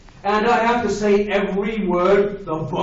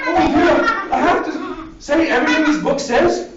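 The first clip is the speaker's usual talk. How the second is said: higher, hammed-up shock at the rule; mock dismay; 5 semitones higher, faster than usual. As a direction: play it as a horrified reader.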